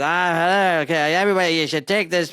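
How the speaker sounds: mock a bum hawking to passers-by; street bum. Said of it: "if you go to some bum".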